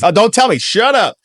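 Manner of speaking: high, put-on voice waving it all away; high hammed-up Lloyd Austin impression; Lloyd Austin playing dumb. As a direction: play a flustered official who doesn't want to know. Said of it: "Lloyd Austin's".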